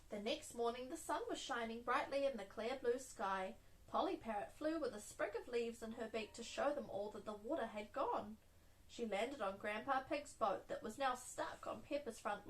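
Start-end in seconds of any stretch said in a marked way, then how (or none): none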